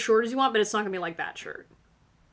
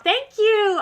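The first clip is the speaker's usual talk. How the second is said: high, bright burst of thanks; light up at the compliment; squealed thank you; delighted thanks; 12 semitones higher, 9 dB louder than usual. Real, not acted.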